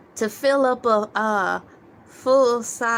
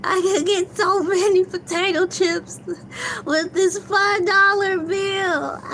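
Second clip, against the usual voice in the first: higher, wailing through laughter; crying with laughter at a small joy; laughing so hard it sounds like crying; 6 semitones higher than usual. Real, not acted. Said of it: "I'm crying".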